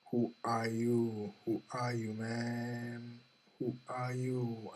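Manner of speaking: slow, level, stretched-out refrain; drawn-out questioning. Real, not acted. Speaker keeps asking listeners who they are, drawn-out questioning. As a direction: draw out each word on a held, even pitch.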